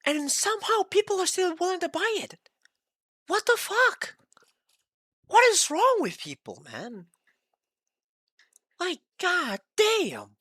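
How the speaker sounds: high-pitched, disbelieving outburst; exasperated rant. Real, not acted. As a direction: pitch shoots way up in outrage at buyers. Ranting about people buying it.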